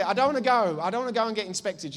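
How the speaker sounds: loud, high complaint; retold protest. Husband balking at a doctor's visit, loud protest. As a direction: object loudly, pitched well up.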